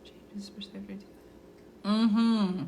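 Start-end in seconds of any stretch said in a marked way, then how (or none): 0.0-1.0 whispered, barely audible; reading under breath. Real, not acted. Reader going over a client's note, whispering. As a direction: whisper it to yourself as you read.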